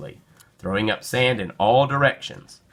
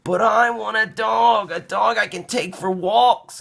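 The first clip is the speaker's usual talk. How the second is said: put-on high, pleading little boy voice; insisting child; 12 semitones higher than usual. Caillou begging Daddy for a dog, insisting. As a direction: insist like a small boy at bedtime. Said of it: "Caillou insisted".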